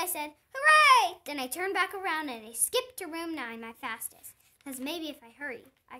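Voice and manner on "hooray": a big, loud, high hooray; cheering. Acted. Junie cheering to Principal she'll see him again, loud high hooray. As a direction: throw your arms up and cheer it.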